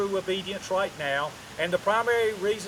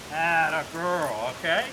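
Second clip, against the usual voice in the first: drawn-out praise; 2 semitones lower, slower than usual. Shown as a demonstration. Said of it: "I praise her at the end of that consequence".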